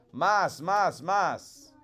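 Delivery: teacher modelling "Más, más, más" in Spanish; Spanish model words. Shown as a demonstration. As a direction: model "Más" three times for repeating. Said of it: "repeat after me".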